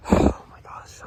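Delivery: whispered, out of breath; breathless whisper. Real, not acted. Detectorist catching his breath after the find, whispered awe. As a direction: breathe it out, barely voiced. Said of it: "trying to catch my breath".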